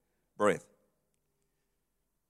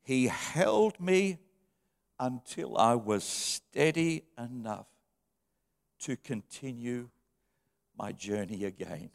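tender reading; 5 semitones lower, 6 dB quieter, slower than usual. Acted.

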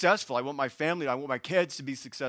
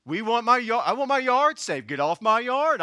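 higher-pitched, hammed-up protective old homeowner; that old guy; 7 semitones higher, 4 dB louder than usual. Preacher playing that old guy guarding his yard, hammed-up indignation. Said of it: "I'm that old guy".

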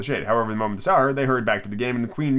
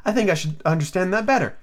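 politely puzzled, pitched up a little; 7 semitones higher than usual. A narrator's reading. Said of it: "said Alice very politely".

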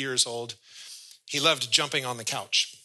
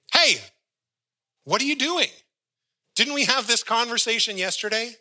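high, loud warning voice; scolding Holy Spirit; 9 semitones higher, 4 dB louder than usual. Acted. Holy Spirit warns the believer off sin, high loud scolding. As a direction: call out sharply like a parent stopping a child.

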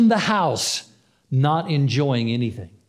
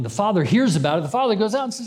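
usual speaking voice

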